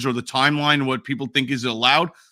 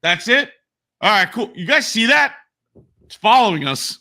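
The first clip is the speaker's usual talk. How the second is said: clueless boat driver; 4 semitones higher than usual. Retold or imitated. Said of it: "if I was the dude driving the boat".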